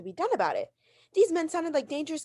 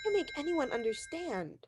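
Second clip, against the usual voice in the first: despairing mutter; 2 semitones higher, 7 dB quieter than usual. Acted. Woman alone after hanging up.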